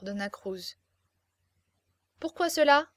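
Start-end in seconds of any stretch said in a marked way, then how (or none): 2.2-2.9 voix haute et émerveillée; elle décrit sa mère rêvée; jeune fille rêveuse. A narrator's reading. Dona Cruz presses. (jeune fille rêveuse, voix haute)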